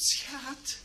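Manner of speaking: high, soft, drawn-out call; lightly acted bride calling to her husband; bride calling softly. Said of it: "En ze roept". (zacht en hoog roepend bruidje)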